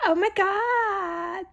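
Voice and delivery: slow, high drawn-out oh my God; imitated exclamation. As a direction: stretch it out like the crowd did. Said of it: "They kept talking like that".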